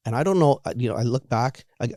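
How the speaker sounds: normal talking voice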